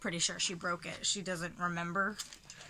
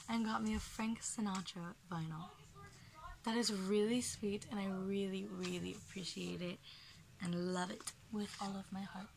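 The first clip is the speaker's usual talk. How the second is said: softer, moved and sincere; 8 dB quieter than usual. Real, not acted. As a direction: speak gently, touched by the gift. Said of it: "my voice just got a lot softer".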